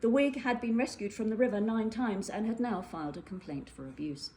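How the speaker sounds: ordinary delivery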